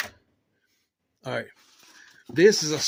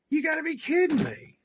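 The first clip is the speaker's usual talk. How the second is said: high, incredulous cry of disbelief; 14 semitones higher, 3 dB louder than usual. Real, not acted. Friend in the shop, stunned by the parts: high incredulous disbelief. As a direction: pitch it way up, can't believe it. Seeing limiters on the back.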